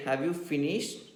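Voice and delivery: rising tune on "finished"; questioning tune. Shown as a demonstration. Teacher checks if pupils finished their notes, questioning tune. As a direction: ask it with the voice lifting on "finished". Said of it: "Intonation means the tune with which you speak".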